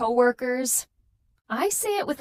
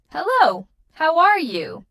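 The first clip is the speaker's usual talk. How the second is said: louder, smiling hello to a new person; friendly smiling greeting; 5 semitones higher, 7 dB louder than usual. Shown as a demonstration. Teacher showing learners a friendly greeting, smiling and louder. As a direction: greet them like you're glad to see them. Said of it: "I say it with a smile to sound friendly".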